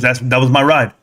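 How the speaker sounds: lightly voiced old father, steady and sure; a hint of a wise dad at peace with fate; his calm father. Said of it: "He goes".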